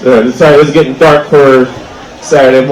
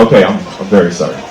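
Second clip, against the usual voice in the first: meek apology; 10 semitones lower, 4 dB quieter, faster than usual. Retold or imitated. Meek apology to angry ex-wife.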